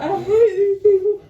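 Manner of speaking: high tearful whine; mock crying. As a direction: blubber it in exaggerated self-pity. Repeats tearful phone wail.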